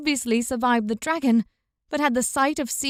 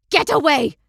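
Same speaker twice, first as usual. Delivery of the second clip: loud, furious snap; spat with fury; 3 semitones higher, 6 dB louder than usual. Acted. Father furiously driving Una away from the ritual. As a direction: spit it at her in rage. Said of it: "her father spat with such fury".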